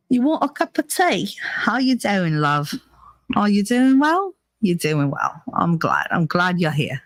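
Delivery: playful British tea-time chat; British accent with swooping questions; mock British accent. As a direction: put on a chummy British accent. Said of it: "do the, the British accent".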